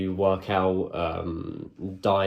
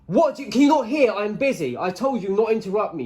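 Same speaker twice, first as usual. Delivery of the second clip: annoyed shout; 12 semitones higher, 4 dB louder than usual. Real, not acted. Shouting back at interrupting flatmate.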